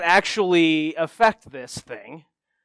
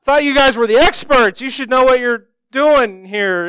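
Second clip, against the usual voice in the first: higher, louder fake outrage while backing away; mock indignant deflection; 6 semitones higher, 6 dB louder than usual. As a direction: play innocent and blame them, hammed-up. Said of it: "slinking out of the room going".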